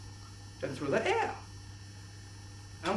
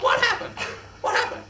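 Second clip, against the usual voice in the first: ordinary talk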